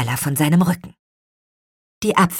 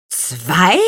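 loud, rising one-word question; surprised exclamation, swooping up; astonished call; 5 dB louder than usual. A narrator's reading. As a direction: echo it in disbelief. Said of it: "riefen die anderen".

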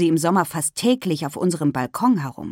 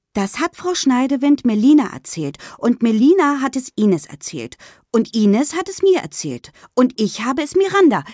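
put-on higher girl's voice, chaining the rumour along; high, louder child passing on gossip; young schoolgirl Lynn; 7 semitones higher, 4 dB louder than usual. A narrator's reading. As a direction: rattle off who told whom, pitch climbing at the end.

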